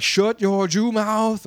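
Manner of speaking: high, drawn-out mock command; mock angry movie villain. Retelling the shooter's line, mock angry and stretched out. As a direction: imitate an angry action-film bully, slowly. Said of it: "he just, like".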